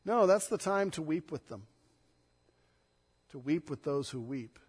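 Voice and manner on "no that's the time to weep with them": normal talking voice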